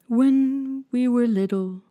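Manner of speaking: slow, tearful and halting; sobbing a little. A narrator's reading. Mock Turtle recalling his schooldays, mildly tearful. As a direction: hold back a little sob. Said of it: "though still sobbing a little".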